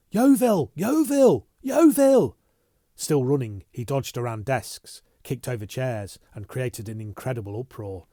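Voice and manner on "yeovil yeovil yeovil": high, loud frantic yells; shouting. Acted. Bunny racing through the offices, yelling for Yeovil, high and loud. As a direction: yell the name like a panicked alarm. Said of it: "Bunny began to shout".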